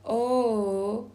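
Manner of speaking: O drawn out long; isolated vowel sound. Shown as a demonstration. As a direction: demonstrate O as one long, held vowel. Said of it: "I am going to pronounce".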